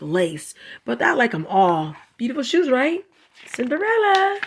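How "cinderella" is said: playful exclaim. Real, not acted. Naming the shoes playfully.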